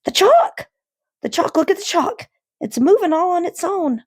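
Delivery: put-on boy screaming at the moving chalk; hysterical scream. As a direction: shriek it in panic. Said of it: "He was screaming".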